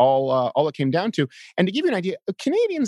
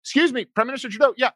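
reporter calling out; 10 semitones higher than usual. Retold or imitated. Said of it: "someone says".

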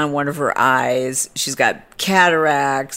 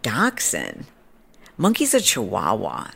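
low, softer deadpan retort; a little snarky; 4 semitones lower, 4 dB quieter than usual. Retold or imitated. Snarky owner correcting a stranger's breed guess, low and dry. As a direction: dryly correct someone, a touch incredulous. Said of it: "a little bit of a snarky mood".

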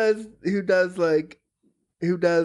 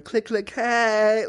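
slow, higher click click hey; voiced chair horn; 6 semitones higher, much slower than usual. Pitching a custom horn to a maker, slow higher chirpy call. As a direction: stretch out a silly horn call. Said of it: "a horn that goes with our voices going".

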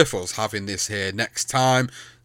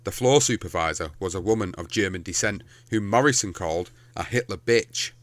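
measured, lower reading voice; story narration; 4 semitones lower, 4 dB quieter than usual. A narrator's reading. Podcaster reading the script to listeners, measured narration. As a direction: read it out as a serious narrator. Reads a story line aloud.

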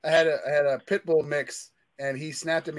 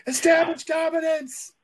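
mock shout; 14 semitones higher than usual. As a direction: bark it like a joking war cry. Joking loud declaration.

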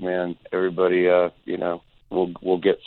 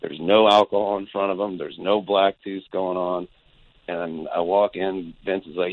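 normal talking voice

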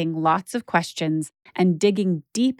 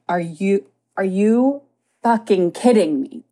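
incredulous, halting build to mild exasperation; retold anger, only slightly raised; retold outrage. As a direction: replay your disbelief, restarting before the swear. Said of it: "my head exploded".

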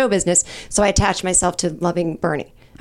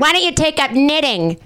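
heckler; 6 semitones higher than usual. Acted. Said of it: "That's me being the peanut gallery".

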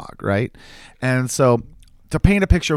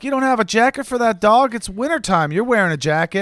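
higher-pitched mild sass; snappy, sassy neighbor; 9 semitones higher than usual. Acted. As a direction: lightly imitate a snappy neighbor scolding. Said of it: "she's kinda snappy, a little sassy".

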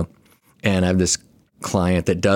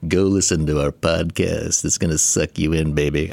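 velvet seductive voice; 3 semitones lower than usual. Pretend. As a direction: lay it on thick like a smooth radio seducer. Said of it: "you might have the velvety intro".

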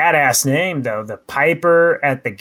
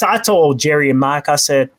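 ordinary delivery

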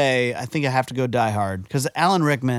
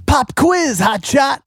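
mock movie quote; 7 dB louder, slower than usual. Quoting Speed villain line.

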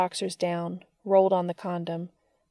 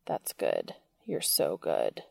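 low, warm praise; 5 dB quieter, slower than usual. Acted. Cass praising, low and intimate.

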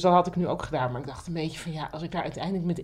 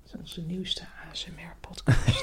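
slow, softer unvoiced whisper; ASMR whisper; 4 dB quieter than usual. Joking to her partner about an ASMR podcast, slow whisper. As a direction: hush it like an ASMR host. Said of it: "ASMR-podcast".

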